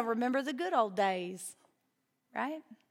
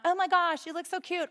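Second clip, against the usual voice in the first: high, gushing mock flattery; a light mock gush, pitched up; gushing flatterer; 7 semitones higher than usual. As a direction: coo it like an empty flatterer.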